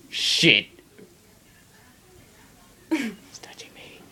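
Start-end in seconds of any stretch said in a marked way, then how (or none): 2.9-4.0 hushed whisper. Real, not acted. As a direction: whisper it close to the mic. Whispered aside.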